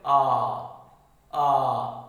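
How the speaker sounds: आ held long, mouth wide open; open-mouth "aah". Shown as a demonstration. Patient told by doctor to open the mouth, long open आ. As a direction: say आ as for a doctor's examination. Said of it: "तो वो कहता मुंह खोलो".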